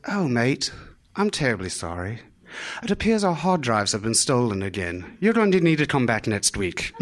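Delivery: a hint of a polite English barrister; British accent. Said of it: "a very proper British barrister".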